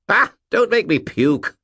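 high, mocking spirit voice; cheeky skull threatens; warning Bob. A narrator's reading. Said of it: "Bob warned him".

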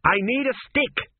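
high-pitched narrated shout. A narrator's reading. Rider whirls past the sergeant needing a stick, shouting. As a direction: call it out urgently, voice leaping up. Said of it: "shouted Tepic as he whirled past".

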